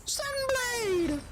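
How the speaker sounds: high yelled skill name; shouted attack callout. Real, not acted. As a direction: belt out the move name. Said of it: "I just like saying that".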